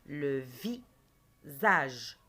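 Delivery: "Le visage" very slow, long gap between vi and sage; "Le visage" split slowly for pupils to hear; syllable by syllable. Shown as a demonstration. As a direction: break "Le visage" after vi. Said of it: "Je dis le mot à haute voix".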